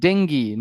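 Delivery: dengue said "deng-gee"; correct pronunciation. Shown as a demonstration. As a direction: model the right dengue again. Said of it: "it is pronounced as".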